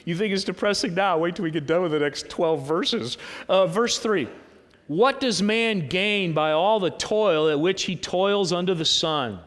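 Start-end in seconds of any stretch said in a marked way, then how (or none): 4.9-9.4 slow, emphatic sermon reading; scripture reading. Acted. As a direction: read the verse out deliberately, drawing out key words. Reads scripture aloud.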